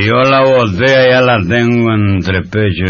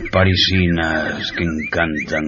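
put-on drunken dreamy reverie; 6 semitones lower, 4 dB quieter than usual. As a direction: sigh it out, lost in memories.

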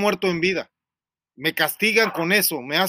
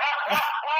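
high giggle breaking the story; can't hold the laugh in; burst of laughter; 8 semitones higher, 4 dB quieter than usual. Real, not acted. Laughing at his own boast, a high giggle.

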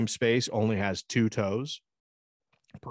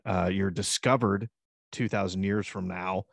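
normal talking voice

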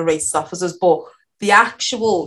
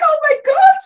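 very high, loud mock-horrified cry; aghast disbelief; 6 dB louder than usual. Seeing police high-five the far right, aghast. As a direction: squeal it in retold shock.

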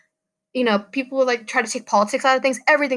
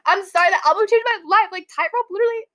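gushing, pitched way up; hyped up; 9 semitones higher than usual. Real, not acted. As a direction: gush about the album, fully hyped. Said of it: "I'm getting very hyped up".

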